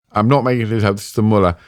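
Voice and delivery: ordinary delivery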